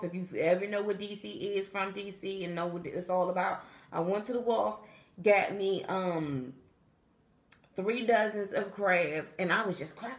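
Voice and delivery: normal talking voice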